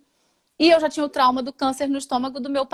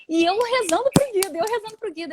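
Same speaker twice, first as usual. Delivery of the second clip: higher, pressing retelling; urgent; 7 semitones higher than usual. Retold or imitated. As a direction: stress how hard she prayed. Praying anxiously while surgery happened.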